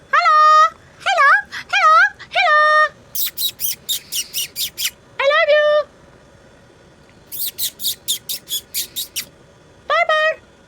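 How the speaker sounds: pet-talk shout; high-pitched calling. Real, not acted. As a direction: call the pet in a very high, loud sing-song.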